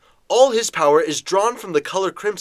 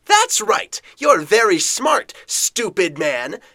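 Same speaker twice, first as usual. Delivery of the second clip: high, slow mocking villain; 4 semitones higher, 5 dB louder, slower than usual. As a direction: sneer it at the hero.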